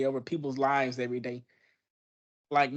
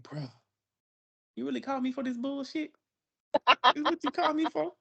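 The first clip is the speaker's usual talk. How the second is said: incredulous, fed-up complaint, pitched up; exasperated; 10 semitones higher than usual. Retold or imitated. To his co-parent, an exasperated bruh. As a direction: disbelief at a pointless call. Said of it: "sometimes I be like".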